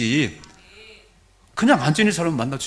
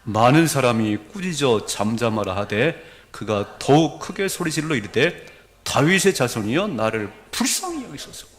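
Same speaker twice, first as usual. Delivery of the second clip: scripture reading; 4 semitones lower, 4 dB louder than usual. Real, not acted. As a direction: lead the congregation through the verse, weighting each phrase. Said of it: "같이 읽습니다". (성경을 힘주어 천천히 낭독)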